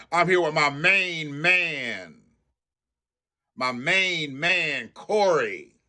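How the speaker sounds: slow, drawn-out mock intro; lightly imitating a smooth politician; mocking the congressman. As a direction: stretch out my main man lazily. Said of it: "he wanna start it out".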